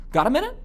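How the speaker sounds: "Got a minute" lifted high into a question; reasonable man. Shown as a demonstration. Caller making a reasonable request, "Got a minute" rising high. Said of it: "with that tonality".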